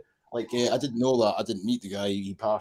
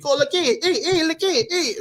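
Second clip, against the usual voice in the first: much higher, loud mock drunken calling; 6 dB louder than usual. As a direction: imitate a tipsy ex shouting hey, high and loud. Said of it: "when she would get going on the drink".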